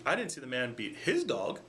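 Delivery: "his" lifted sharply above the other words; stress on HIS. Shown as a demonstration. Explaining it wasn't his dog, stress on "his". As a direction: hit "his" so it means someone else's dog. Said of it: "stressing and emphasizing a different word".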